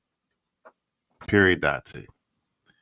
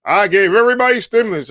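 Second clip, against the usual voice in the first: mock bragging; 11 semitones higher, 5 dB louder, faster than usual. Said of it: "He would have came out like".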